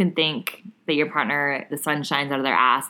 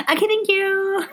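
high, sweet thank-you voice; chirpy polite client voice; customer service voice; 4 dB louder than usual. Retold or imitated. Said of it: "give me money".

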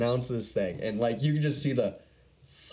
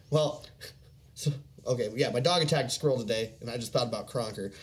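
normal talking voice

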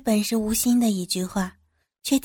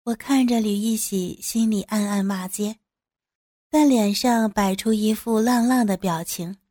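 plain reading voice

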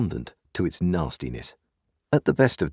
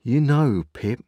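solemn Joe; 7 semitones higher, 4 dB louder, slower than usual. A narrator's reading. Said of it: "said Joe solemnly".

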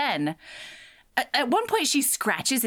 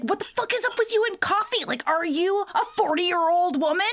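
high-pitched exasperated rant; mock angry; 7 semitones higher than usual. Pissed-off guy to the woman offering coffee, mock angry. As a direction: fire off incredulous, hammed-up irritation. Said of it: "He's so angry".

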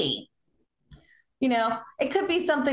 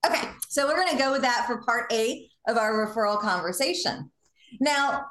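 normal talking voice

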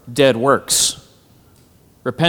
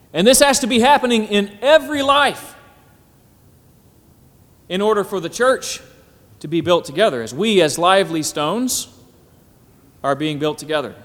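preaching; 4 semitones higher, 4 dB louder than usual. Acted. Preaching at full force.

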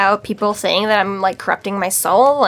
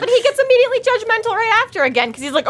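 ordinary delivery

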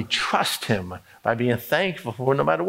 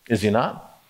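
ordinary talk

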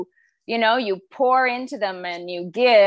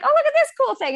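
much higher, thrilled; excited about a bug; 3 dB louder, faster than usual. Retold or imitated. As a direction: show off a cool bug with glee. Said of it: "I'm like".

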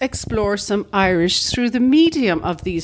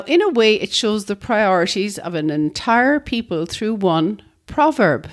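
ordinary talk, nothing marked

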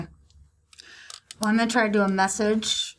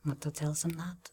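whispering; 3 semitones lower, 12 dB quieter than usual. Real, not acted. Whispered aside.

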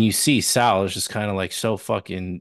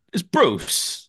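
higher mock Pino voice, insisting; imitating Pino; 9 semitones higher than usual. Pino insisting to Mookie it's [name], higher mock voice. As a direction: play Pino stubbornly naming his favorite, pitched up. Retelling Pino's line in film.

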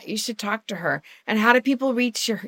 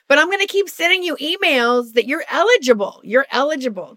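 higher chirpy automated-notice voice; mock official announcement; 5 semitones higher, 4 dB louder than usual. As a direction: keep nagging cheerfully like a robot notice. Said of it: "New Jersey keeps going".

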